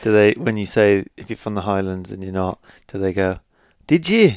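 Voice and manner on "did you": high doubtful "did you?"; mock Scottish incredulity. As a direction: skeptical Scottish local. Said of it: "With the eye roll, the Scottish eye roll".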